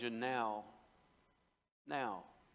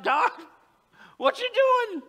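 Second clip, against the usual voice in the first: high, loud cry to God; exasperated outcry; 12 dB louder, slower than usual. Acted. Believer questioning God, loud exasperated yell. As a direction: yell it upward in baffled protest. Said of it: "where you just thought".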